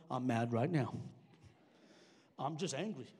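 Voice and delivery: low-pitched mock grumpiness; mock angry. Preacher voicing someone mad in the congregation, mock angry. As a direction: act mad with the voice dropped low.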